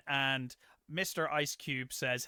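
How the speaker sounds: normal talking voice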